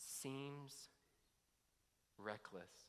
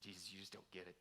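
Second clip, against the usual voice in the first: wounded plea; 6 semitones lower, 7 dB quieter than usual. Retold or imitated. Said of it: "Or three".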